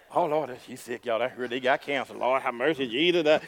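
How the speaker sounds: low, hushed mock lament. Church member passing on a cancer diagnosis, mock lamenting. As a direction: imitate a gossip bemoaning bad news.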